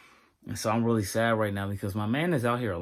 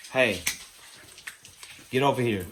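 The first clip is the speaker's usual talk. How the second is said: calling the dog; 3 semitones higher, 4 dB louder than usual. Real, not acted.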